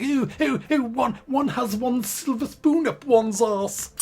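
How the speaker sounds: high, slow mock upper-class English accent; mock posh English. Mocking how the English talk in the game, slow posh English accent. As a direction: ham up a snooty English accent, slowly. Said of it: "makes them talk like".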